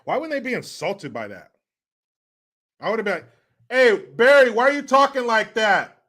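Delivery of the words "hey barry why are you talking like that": mock challenge. Acted challenge to the politician.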